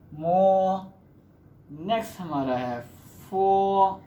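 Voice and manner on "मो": मो said louder and held, Chinese m; Chinese initial demo. Shown as a demonstration. Teacher models the Chinese m syllable for students, loud clear मो. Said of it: "हम इसको लिखेंगे".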